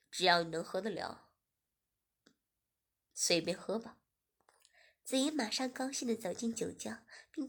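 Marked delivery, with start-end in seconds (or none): 0.1-4.0 lower, easy-going man; male host voice. Acted. Host telling Ziying to drink freely, lower. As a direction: drop low and relaxed as him.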